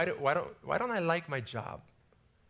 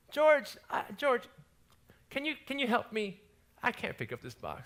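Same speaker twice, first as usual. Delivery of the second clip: put-on old lady asking for help; 10 semitones higher than usual. Old warehouse lady calling the young worker, high and pleading.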